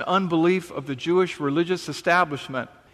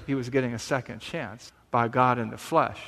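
normal talking voice

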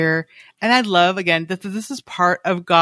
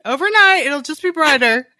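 mock quote; 7 semitones higher than usual. Mocking the friends' advice.

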